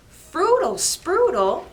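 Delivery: high loud gleeful nonsense; silly nonsense. Acted. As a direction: blurt the silly rhyme with glee. Bluebird says silly words.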